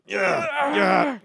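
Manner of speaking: high, wailing shriek; crying scream. Acted. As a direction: scream in panic. Friend about to jump.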